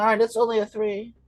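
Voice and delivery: lightly voiced little elf; a hint of a storybook elf; Keebler elf voice. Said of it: "What is this old Keebler elf voice?".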